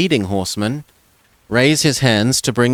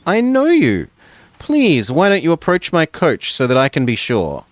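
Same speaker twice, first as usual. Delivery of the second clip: much higher, refined lady's voice; refined noblewoman; 6 semitones higher, 3 dB louder than usual. Acted. Noblewoman calls to the stranger by the road, refined and high. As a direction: call out like a gracious noble lady. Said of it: "with a refined Palmyrean accent".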